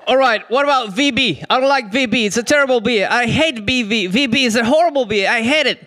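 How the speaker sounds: mock whiny complainer. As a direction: moan about the food like a fussy tourist. Acted chat with Australian mentor.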